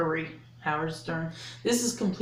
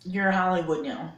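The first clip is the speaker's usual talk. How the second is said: normal talking voice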